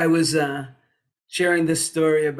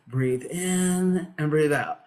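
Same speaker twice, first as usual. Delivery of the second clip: meditation teacher; slower than usual. Retold or imitated. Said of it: "so he's teaching meditation".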